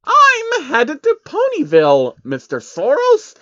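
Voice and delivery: very high, loud, slow beaming reply; proud and delighted; beaming Trixie. A narrator's reading. Trixie beams as she tells Soros her destination. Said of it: "Trixie beamed".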